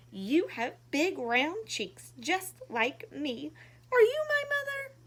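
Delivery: high, loud little bird's plea; little bird crying out. Acted. Lost little bird crying to the giraffe, high and loud. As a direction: call out like a lost chick. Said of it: "he cried".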